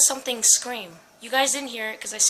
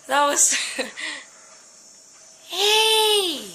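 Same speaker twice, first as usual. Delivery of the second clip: shaky laughing after a scare; nervous laugh; 4 semitones higher, much slower than usual. Real, not acted. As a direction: laugh off the fright.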